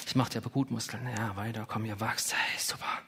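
hushed mock pep talk to dumbbells; mock coaxing whisper. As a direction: pretend to coax muscles with a soft whisper. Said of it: "wenn du dir die Hanteln anschaust und sagst".

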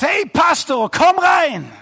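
high, loud shout across the office, calling him in. Retold or imitated. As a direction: boss yells from the glass office to the passing pastor, loud and high. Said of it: "dann schreit jemand raus".

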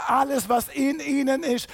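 ordinary delivery

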